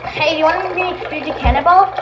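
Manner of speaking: ordinary talk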